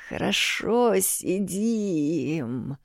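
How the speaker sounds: sluggish, dismissive wave-off; lazy brush-off. A narrator's reading. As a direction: brush it off lazily. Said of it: "вяло отмахнулся его величество".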